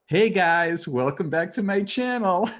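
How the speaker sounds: high, loud YouTuber intro; kid YouTuber voice. Retold or imitated. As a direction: hype it up like a kid vlogger. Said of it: "he's like".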